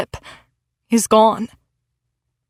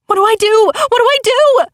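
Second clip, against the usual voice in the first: high panicked repeated question; frantic; 11 semitones higher, 3 dB louder, faster than usual. A narrator's reading. Narrator panics as Veronica blocks the truck, frantic high voice. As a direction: voice climbing in panic, much higher. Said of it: "I ask, frantically".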